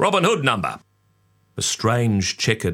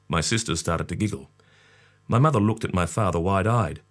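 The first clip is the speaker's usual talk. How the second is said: ordinary delivery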